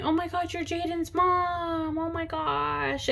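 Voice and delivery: put-on excited kids. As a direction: imitate the friends gushing over meeting her. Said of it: "they came running up to me".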